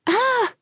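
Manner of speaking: very high, startled "ah"; shouted cry. A narrator's reading. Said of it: "the kids shouted".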